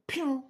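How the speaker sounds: high loud mock gunshot pew; gunshot noise. Mimicking a gunshot while dropping forties, loud pew. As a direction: make a quick toy-gun pew.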